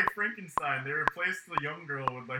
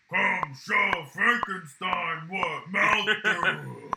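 monster voice; 4 semitones higher, 6 dB louder, much slower than usual. Retold or imitated. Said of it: "he's just like".

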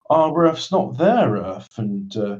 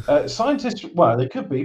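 ordinary delivery

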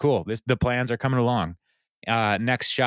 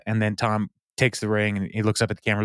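normal talking voice

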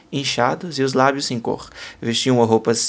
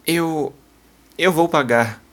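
shaky halting voice; 3 semitones higher, slower than usual. Acted. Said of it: "com uma voz trêmula e pausada".

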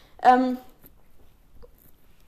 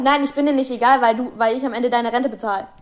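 ordinary talk, nothing marked

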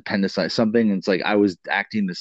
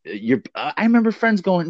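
ordinary talk

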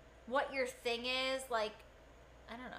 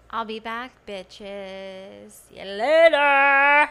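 much louder, slow shouted farewell; shouted outro catchphrase; catchphrase sign-off; 21 dB louder, much slower than usual. Pretend. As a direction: bellow the sign-off to the crowd.

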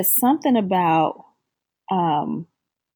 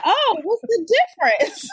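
raised, laughing question; high laughing quote. Retold or imitated. Mother to daughter in the car, high and laughing. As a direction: ask it high through a laugh. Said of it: "And I said".

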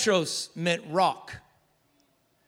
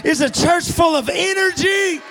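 preaching at full force; 9 semitones higher, 6 dB louder than usual. Acted. Pastor firing up the congregation, full-force preaching. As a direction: build the sermon to a roar.

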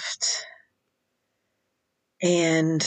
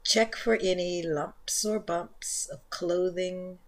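soft, unhurried meditation voice, much softer than the intro; guided meditation; 11 dB quieter than usual. Acted. Leads guided yin yoga meditation.

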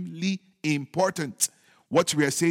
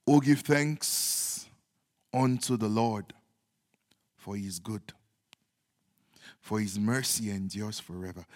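reverent scripture reading; 7 semitones lower, 4 dB quieter than usual. Real, not acted. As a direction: read it prayerfully with long pauses. Reads the psalm aloud.

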